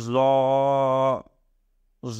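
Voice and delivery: "জ" as a buzzing "z" with a long rounded vowel; model pronunciation. Shown as a demonstration. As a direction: hold each "জ" long and level, pausing between. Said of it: "উচ্চারণ করার সময় এভাবে করব".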